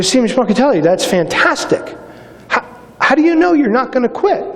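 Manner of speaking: surprised. Retold or imitated. Officer's own surprised reply quoted.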